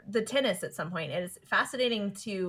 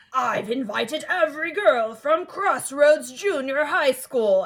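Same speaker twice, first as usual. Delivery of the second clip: strong bad voice; 5 semitones higher, 6 dB louder, slower than usual. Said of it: "he's doing it in a strong bad voice".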